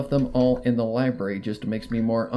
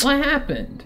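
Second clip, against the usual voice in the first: much higher, pressing question; demanding pony; 13 semitones higher than usual. Acted. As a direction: push for an answer. Rainbow voiced in story.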